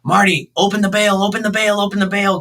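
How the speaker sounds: urgent high-pitched mock yell, rapid repeats; high, pressing retold shout. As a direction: yell a frantic instruction, retold at moderate volume. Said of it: "and I'm yelling at him".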